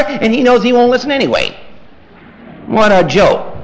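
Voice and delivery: ordinary talk, nothing marked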